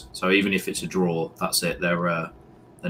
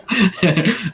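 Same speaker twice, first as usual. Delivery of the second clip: bursting laugh; 11 semitones higher, 4 dB louder than usual. Real, not acted. Laughs at own phrase.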